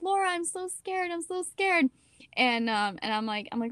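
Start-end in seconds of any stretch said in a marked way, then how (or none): 0.0-1.9 put-on high, small frightened kid voice; imitating scared little sister. Little sister begging her big sister at bedtime, high and scared. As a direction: mimic a little sister too scared to sleep. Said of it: "she'd be like".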